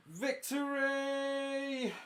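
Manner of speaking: slow, soaring victory call at the battle's end; triumphant cry. Acted. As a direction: stretch the word out slowly like a victorious hero.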